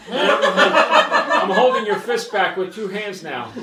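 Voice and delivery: loud, high-pitched, cracking up mid-story; real laughing. Real, not acted. Brother retells strength test, laughing hard. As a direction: struggle to talk through laughter.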